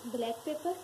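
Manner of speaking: usual speaking voice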